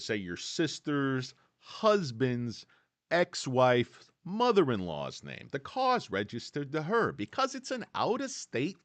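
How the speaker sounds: hammed-up upstate New Yorker back-and-forth; mock New Yorker chat. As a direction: act out a small-talk exchange with a New Yorker. Acted New York fireman exchange.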